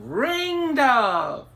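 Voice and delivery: high, loud calling out; screaming her name. Acted. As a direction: call her name from far away. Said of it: "They were screaming out her name".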